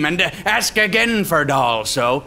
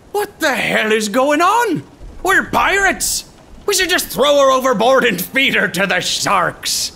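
higher, louder, indignant outburst; outraged pirate; 9 semitones higher, 4 dB louder than usual. Acted. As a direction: blow up at your mate in disbelief. Captain outraged at Pete.

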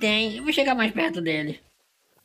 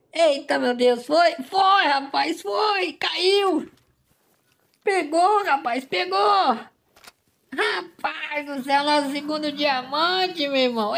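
real thrilled cries, much higher; excited cheering; 7 semitones higher, slower than usual. Real, not acted. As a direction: yell with joy that it dropped. The shot hits, animal falls.

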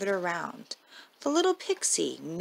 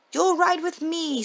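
high, louder happy call; joyful shout; 9 semitones higher, 5 dB louder than usual. Acted. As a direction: announce it with joy. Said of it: "Santa shouted with joy".

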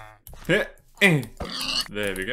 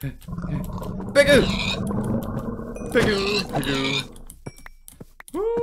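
high sing-song calling; 8 semitones higher, slower than usual. Real, not acted. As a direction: call the pigs up high.

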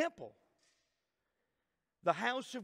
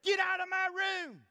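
high, loud shout at a parent; kids yelling; 11 semitones higher, 5 dB louder than usual. Retold or imitated. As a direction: yell it like a kid guarding their room. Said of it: "they would say".

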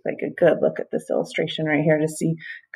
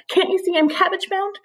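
high snail voice; 13 semitones higher than usual. Acted. Snail protests to crow.

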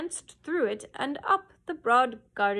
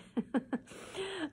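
soft amused chuckle; light laugh; 7 semitones higher, 5 dB quieter than usual. Real, not acted. Narrator laughing at the dancing cow, soft chuckle. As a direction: laugh gently at the story.

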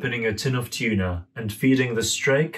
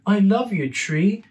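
put-on high, sweet little boy; 9 semitones higher, 3 dB louder than usual. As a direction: say it like a small child, high up. Child Dimitri voiced in story.

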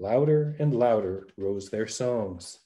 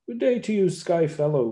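lightly voiced listener calling up, higher; man calling back; 6 semitones higher than usual. A narrator's reading. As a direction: shout a friendly greeting up to the ship. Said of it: "cried the man".

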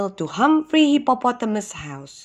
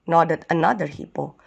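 usual speaking voice

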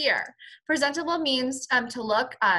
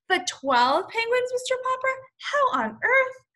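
astonished; 4 dB louder than usual. Acted. Admiral astonished at twelve penguins.

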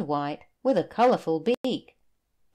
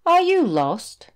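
much higher and louder gentle question; concerned whale; 10 semitones higher, 8 dB louder than usual. Acted. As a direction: lean in and ask kindly, voice lifted. Whale asks lost boy.

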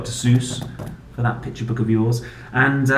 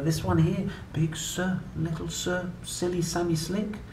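lively sing-song picture-book reading; reading to children; 6 semitones higher, 6 dB quieter than usual. Acted. Parent reading an ABC book to a child, playful sing-song. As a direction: read it out like a bedtime book for a small child.

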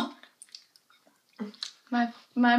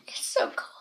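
whispered; 7 dB quieter, faster than usual. Real, not acted. Mouth freezing from popsicle.

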